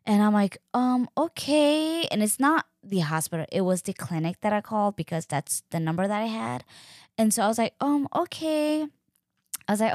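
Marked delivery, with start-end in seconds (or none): 0.7-2.0 slow, high hesitant okay; drawn out doubtful agreement; unsure okay. Retold or imitated. Hesitant reply to clinic. 7.8-8.9 slow, high hesitant okay; drawn out doubtful agreement; unsure okay. Retold or imitated. Same reply again.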